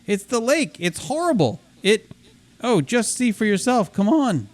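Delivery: high, urgent raccoon voice; a frantic little raccoon begging for help; panicked raccoon. Acted. Voices raccoon game dialogue.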